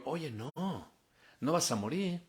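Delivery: soft, drawn-out tempter's coaxing; the serpent's slow, hushed temptation; tempting Satan. Acted. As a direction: coax slowly and quietly, tempting the listener. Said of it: "tentándola a través del orgullo".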